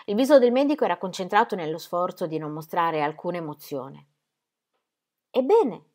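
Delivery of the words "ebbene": "Ebbene?" repeated, louder, with a falling, alarmed edge; strongly alarmed. A narrator's reading. Wife repeating her question to the doctor, alarmed. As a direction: press for the answer, "Ebbene?" full of alarm. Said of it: "ripeté Ingeborg fortemente allarmata".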